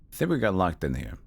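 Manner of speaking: ordinary talk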